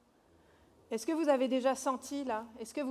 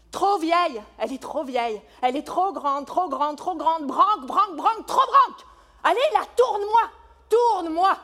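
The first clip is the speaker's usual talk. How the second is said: loud mocking complaint; 4 semitones higher, 12 dB louder than usual.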